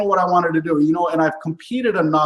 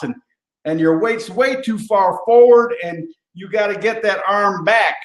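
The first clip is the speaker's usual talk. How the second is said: put-on light country rodeo accent; 4 semitones higher than usual. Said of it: "I got this accent going".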